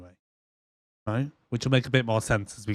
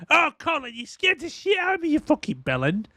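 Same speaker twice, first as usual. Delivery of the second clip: mock Cockney guard; 13 semitones higher than usual. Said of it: "And he's like".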